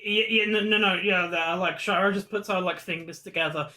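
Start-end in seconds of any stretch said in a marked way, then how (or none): none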